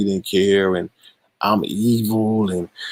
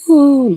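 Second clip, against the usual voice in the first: weary sigh; 9 dB louder than usual. Real, not acted. Sigh before "Lord have mercy.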